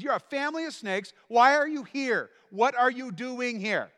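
high loud rebuke; John the Baptist berating the crowd; scolding prophet. Acted. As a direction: scold them like a fiery prophet. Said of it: "He says".

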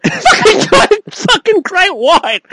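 slow words breaking into high laughter; laughing. Real, not acted. Laughing at his own Jaws joke, cracking up. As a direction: laugh through it, almost crying.

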